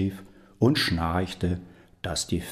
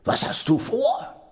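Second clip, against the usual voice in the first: devil shouting; 3 dB louder than usual. Acted. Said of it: "schrie der Teufel".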